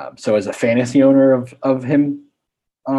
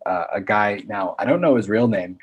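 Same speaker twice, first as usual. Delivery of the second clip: ordinary talk, nothing marked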